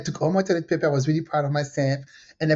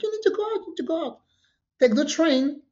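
hosts urging me; 10 semitones higher than usual. Retold or imitated. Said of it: "they were like".

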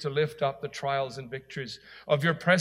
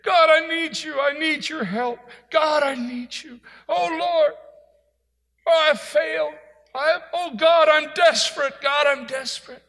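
desperate high-pitched cries to God; strong crying; 11 semitones higher, 6 dB louder than usual. Acted. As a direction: cry out in acted desperate supplication, loud and high. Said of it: "supplication means strong crying".

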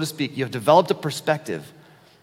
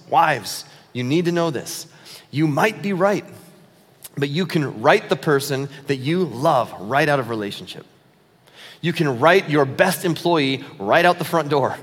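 ordinary talk, nothing marked

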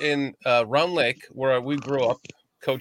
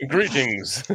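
commercial voice. Retold or imitated. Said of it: "that's that voice you use right there".

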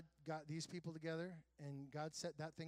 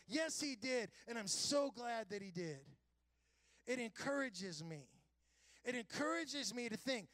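raised, fervent preaching voice; 7 semitones higher, 3 dB louder than usual. Acted. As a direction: preach it with conviction. Preaching at full force.

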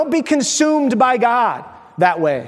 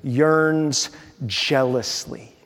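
"yearns jealously" stretched very slow and softer; drawn-out emphasis; 6 semitones lower, 5 dB quieter, much slower than usual. Shown as a demonstration. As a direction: linger on "yearns jealously" to make it sink in. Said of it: "look at this".

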